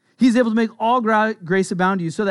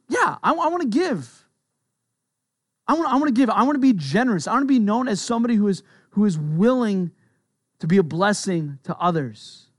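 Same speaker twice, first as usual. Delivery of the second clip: eager willingness; 3 semitones higher than usual. Acted. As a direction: burst out eager to give. Acted willing heart answering God.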